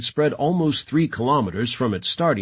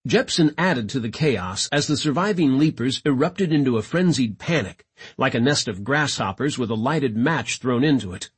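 ordinary delivery

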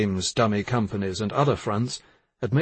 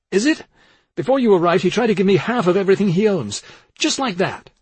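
high, loud, insistent rebuttal; heated retort; 9 semitones higher, 5 dB louder than usual. A narrator's reading. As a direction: argue back hotly for your brother. Dan argues back heatedly at Greitz.

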